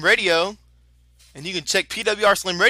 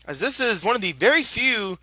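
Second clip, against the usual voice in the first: usual speaking voice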